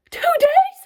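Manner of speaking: incredulous shriek. Real, not acted. Telling viewers about the coworker's confession, incredulous shriek. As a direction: shriek it like you can't believe it.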